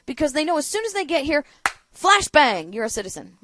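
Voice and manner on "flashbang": sudden loud burst on the word; punchy, explosive delivery; mock flashbang shout. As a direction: blurt it out like a blast. Mimics grenade going off, mock citizenship.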